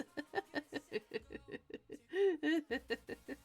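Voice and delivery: long, wheezy giggle fit; helpless laughter trailing on; can't stop laughing at yourself. Real, not acted. Cracks up after own demon voice.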